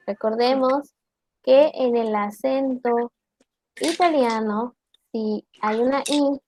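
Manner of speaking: ordinary delivery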